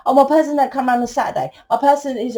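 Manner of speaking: high, rushed lovelorn chatter; mock fretting. Reader mimicking clients obsessed with their person, mock fretting.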